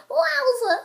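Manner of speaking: very high, loud wowza; meme way. Shown as a demonstration. Trying to be cool, high meme voice. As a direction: shoot it up into a squeal. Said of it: "like, in the meme way".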